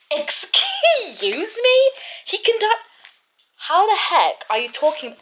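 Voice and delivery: high, slow, stretched-out shocked excuse me; outraged disbelief. Real, not acted. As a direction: react as if you can't believe what you just read. Reader outraged at the character.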